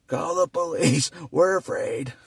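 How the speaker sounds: loud, high panicky plea; mock frightened citizen yelling for police; mock scared caller.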